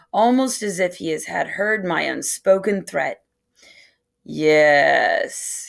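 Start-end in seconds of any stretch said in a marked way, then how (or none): 4.2-5.7 slow. A narrator's reading. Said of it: "he says slowly".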